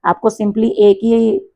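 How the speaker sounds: normal talking voice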